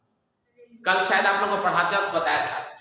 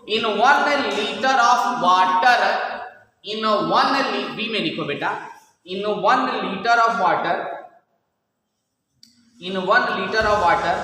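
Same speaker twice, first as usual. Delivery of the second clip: slow, word-by-word dictation for students to copy; much slower than usual. Acted. Teacher dictating a question to the class, slow and measured. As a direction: spell it out slowly so the class can write.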